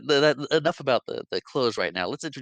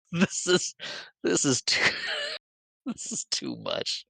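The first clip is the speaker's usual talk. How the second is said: laughing while overwhelmed; 9 semitones higher, slower than usual. Retold or imitated.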